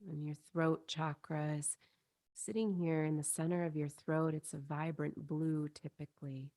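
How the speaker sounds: soothing guided-visualization voice, spacious phrasing; guided meditation. Acted. Leads a chakra meditation.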